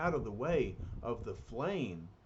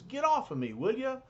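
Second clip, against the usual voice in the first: loud, much higher protest; annoyed complaint; 10 semitones higher, 4 dB louder than usual. Acted. Thing, pinned under Mister Fantastic, complains loud and high. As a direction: shove the words out, fed up and squashed.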